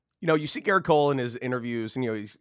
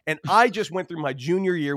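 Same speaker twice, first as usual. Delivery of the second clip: ordinary talk, nothing marked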